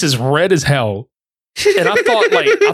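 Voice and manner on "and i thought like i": breaking into a laugh mid-sentence. Real, not acted. Host laughing while retelling to cohost. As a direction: laugh through the words.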